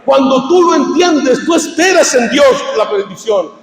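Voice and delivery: high-pitched, fervent preacher's shout; full-force preaching. Real, not acted. Preacher telling believers blessing comes from heaven, full-force preaching. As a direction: drive it upward to a shouted climax.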